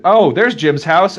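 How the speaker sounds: mock delivery guy. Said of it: "they're probably sending shit to".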